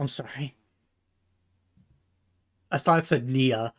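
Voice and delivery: ordinary delivery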